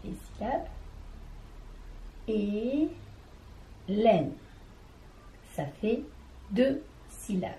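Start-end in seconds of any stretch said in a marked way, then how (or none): 2.3-4.3 Hélène split slowly into its two spoken syllables, Hé and lène; counting the syllables of Hélène on fingers for the class; syllables counted out. Shown as a demonstration. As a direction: tap out Hélène beat by beat. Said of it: "je vais compter avec mes doigts les syllabes".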